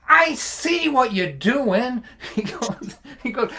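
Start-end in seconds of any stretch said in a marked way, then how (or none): 0.1-2.0 slow, higher and louder, drawn out; knowing retort. Retold or imitated. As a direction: let him know you see through it. Son sees through dad's setup.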